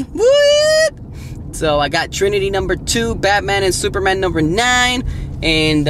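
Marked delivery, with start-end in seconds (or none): 0.0-0.9 very high, loud squealed woo; celebratory whoop. Real, not acted. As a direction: whoop over the haul. Celebrating comic book haul.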